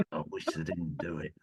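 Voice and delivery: low and quiet through clenched teeth; gritted teeth. Acted. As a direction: grit your teeth and squeeze it out. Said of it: "you said that through gritted teeth".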